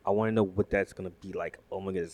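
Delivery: usual speaking voice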